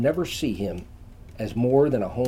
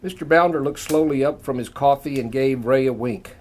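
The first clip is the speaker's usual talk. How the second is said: ordinary delivery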